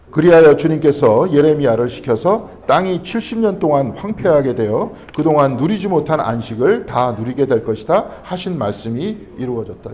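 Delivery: loud, slow, measured Bible reading; scripture reading. Real, not acted. Preacher reads the verse aloud to the congregation, loud and slow. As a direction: read the verse out loud and slowly.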